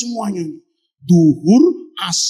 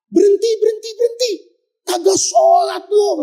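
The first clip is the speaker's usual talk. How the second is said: mock angry shouting; 10 semitones higher than usual. Soldiers quarrel over prayer time.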